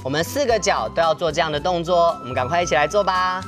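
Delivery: usual speaking voice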